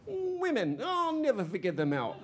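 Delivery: very high comic exasperation; exasperated sigh line. Acted. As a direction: throw up your hands about women. Exasperated man after rejection.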